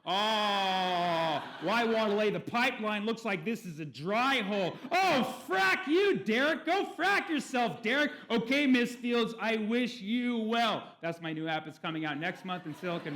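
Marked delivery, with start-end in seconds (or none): 0.1-4.7 loud, higher mock put-down; hammed-up scornful tease; dismissive jab. Guy mocks the girl as a dry hole, loud. App quarrel continues. 4.9-8.1 loud, high mock shout; hammed-up angry screech at Derek; furious yell. Girl screams at Derek on the app, loud and high. 8.3-10.8 loud, slow mock-polite sign-off; hammed-up stiff courtesy; prim farewell. Derek coldly bids Miss Fields goodbye, loud and slow.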